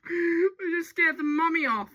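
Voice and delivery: giggling through the words; amused giggle mid-sentence; laughing. Real, not acted. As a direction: laugh as you say it. Laughing at the birds.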